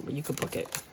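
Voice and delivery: usual speaking voice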